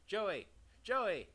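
loud, high-pitched shout of a name; hailing someone by name; calling out. Acted. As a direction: call out sharply, pitch jumping up then falling. Calling out to someone named Joey.

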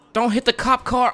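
loud, high-pitched warning; alarmed shout. Real, not acted. Gamer about to hit a police car, alarmed shout. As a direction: blurt a warning at full volume. Warning while driving in game.

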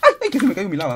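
loud high yelp of dismay; real startled cry. Real, not acted. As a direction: cry out as the lava drops. Drops lava.

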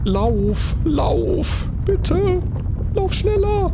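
frantic high-pitched begging; being chased. Real, not acted. Being chased, urging character on.

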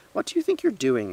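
high, loud indignant demand; scolding shout. A narrator's reading. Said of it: "they shouted".

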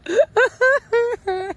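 squealing laugh at the dogs; high burst of laughter. Real, not acted. Owner laughing at the dogs, a high burst of laughter.

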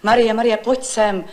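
retold call for help with the laundry. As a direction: call Marie over in a rush. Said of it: "Já vám začnu volat".

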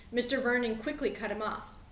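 ordinary delivery, nothing marked

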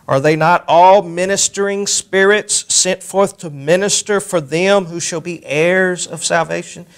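scripture reading. Acted. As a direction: read it out like holy writ. Reads scripture aloud.

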